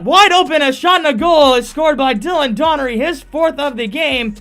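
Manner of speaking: loud, high goal call; long goal yell. Real, not acted. Goal call by play-by-play announcer.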